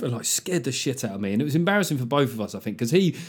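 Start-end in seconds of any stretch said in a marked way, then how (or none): none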